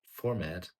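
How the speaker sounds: ordinary talk, nothing marked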